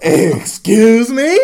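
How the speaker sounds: slow, high, loud incredulous question; hammed-up shock, rising in pitch; mock-affronted disbelief. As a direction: stretch it out as if hugely taken aback. Acts out shocked reply to insult.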